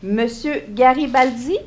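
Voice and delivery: ordinary talk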